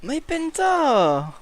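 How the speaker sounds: high, stretched-out anguished cry. Real, not acted. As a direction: cry out in dismay. Lost penta.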